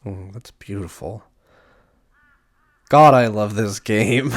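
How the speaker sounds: ordinary delivery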